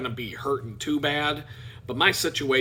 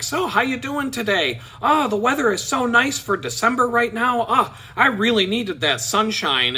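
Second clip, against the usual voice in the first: chatty small talk; 6 semitones higher than usual. Retold or imitated. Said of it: "I'm talking about anything that I can think of".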